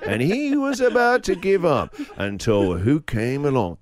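put-on slow, drawn-out old narrator voice; storybook voiceover, stretched out slow; old-timey narrator. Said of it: "an old-timey voice".

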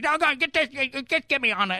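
a riled-up Tennessee caller cursing mildly; Southern caller, fed up. Retold or imitated. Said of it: "he used some choice".

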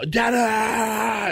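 put-on high excited child's yell; imitating son's happy shout; kid shouting dada. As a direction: call out like a thrilled little boy. Son spotting dad, running over.

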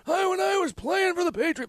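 put-on high shouted old man voice; old guy voice. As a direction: yell it like a cranky old man. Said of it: "My kid loves that voice".

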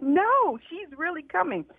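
retold high-pitched protest, softening at the end; insistent. Founder answers the friend who dismissed her, insistent.

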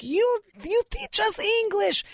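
put-on high, slow immigrant-parent accent; broken-English accent. Parents compliment the TV star, high broken-English accent. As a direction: imitate halting, gushing English with a foreign accent. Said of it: "complimenting her in their broken English".

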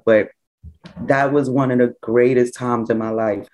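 ordinary talk

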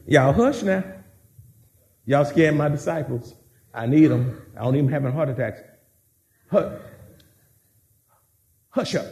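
low, soft, casual "Y'all hush"; calm him down like a parent; Jesus calmly hushing. Acted. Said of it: "I can just see him say".

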